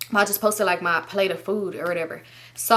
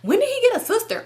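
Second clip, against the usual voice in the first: high-pitched incredulous imitation; mock disbelief; 14 semitones higher than usual. Boyfriend doubting the sister story, mimicked disbelief. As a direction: challenge it in his indignant high voice.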